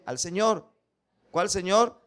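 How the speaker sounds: ordinary delivery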